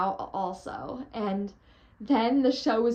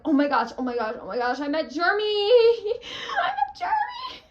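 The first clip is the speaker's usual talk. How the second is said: high, loud shriek breaking into laughter; laughing, crying fangirl scream; ecstatic squeal; 10 semitones higher, 5 dB louder than usual. Retold or imitated. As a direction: scream with joy at meeting him. Ecstatic after meeting idol.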